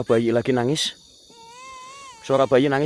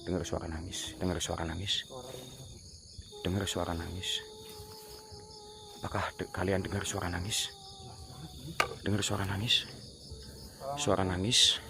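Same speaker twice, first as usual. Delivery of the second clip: very soft, low questioning; hushed listening; 5 semitones lower, 17 dB quieter than usual. Real, not acted. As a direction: ask softly so the faint sound stays audible. Hushed, listening to crying sound. (berbisik lirih sambil menyimak)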